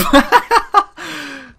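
high, loud cackling laugh; burst of laughter. Real, not acted. Gamer laughing at wild spraying fire, high loud laughter. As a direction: crack up mid-word, high and loud.

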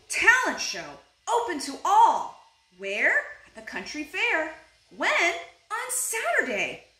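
high, excited announcement; high-pitched announcer for children; reading out an ad. A narrator's reading. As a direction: proclaim it like a big event. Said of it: "It says".

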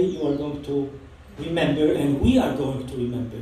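ordinary talk, nothing marked

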